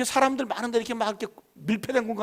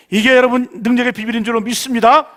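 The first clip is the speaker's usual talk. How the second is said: preaching; 2 semitones higher, 9 dB louder than usual. Acted. Preacher proclaims blessing to congregation.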